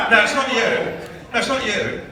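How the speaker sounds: high-pitched, laughing through the words. Real, not acted. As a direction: correct them while still laughing. Laughing, correcting a listener.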